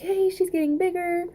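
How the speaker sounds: high, excited little squeal; a giddy, high-pitched cheer about the baby growing; squealed yay. Retold or imitated. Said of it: "I'm just like".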